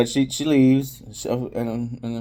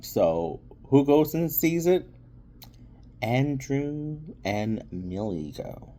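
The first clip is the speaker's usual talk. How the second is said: ordinary talk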